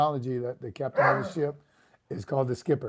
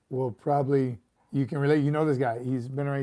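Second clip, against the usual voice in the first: ordinary talk, nothing marked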